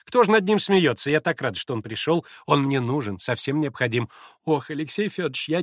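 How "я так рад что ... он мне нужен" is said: usual speaking voice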